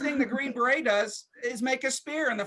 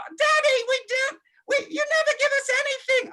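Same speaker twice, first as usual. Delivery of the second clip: put-on much higher kid's whine; whiny child voice. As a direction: whine like a little boy begging his dad. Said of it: "He's like".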